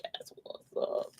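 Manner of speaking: breathy whispered repeat; hushed echo of the catchphrase. Pretend. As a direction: whisper it back to yourself.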